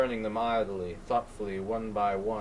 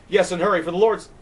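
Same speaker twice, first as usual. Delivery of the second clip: higher, much louder urging; urgent plea; 9 semitones higher, 10 dB louder, faster than usual. Acted. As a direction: push the operator to hurry. Urging the operator.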